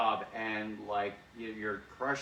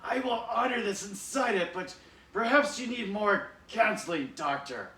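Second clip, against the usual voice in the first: Cobra Commander; 9 semitones higher, 4 dB louder than usual. Retold or imitated. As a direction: scold like a pompous villain. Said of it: "And Cobra Commander".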